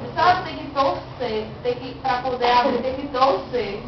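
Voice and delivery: usual speaking voice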